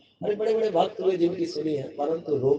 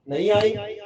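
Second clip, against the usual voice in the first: usual speaking voice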